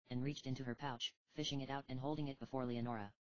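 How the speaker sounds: usual speaking voice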